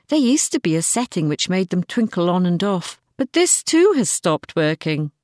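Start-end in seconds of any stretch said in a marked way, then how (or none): none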